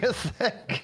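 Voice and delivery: high, laughing repeat; laughing echo. Real, not acted.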